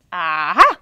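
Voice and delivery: low, loud aha of insight. Acted. Panda spotting the blue-footed boobies, a loud low aha. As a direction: land on the idea. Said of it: "riep hij".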